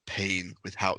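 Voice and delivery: normal talking voice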